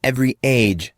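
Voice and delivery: usual speaking voice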